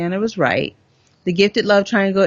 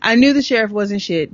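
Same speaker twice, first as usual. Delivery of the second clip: ordinary delivery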